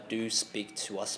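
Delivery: ordinary talk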